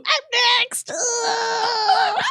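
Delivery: high, squealing cry of terror; hammed-up nightmare shriek; acted strangled scream. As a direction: scream as if paralysed, played for laughs. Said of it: "quietly screaming, like, I can't move".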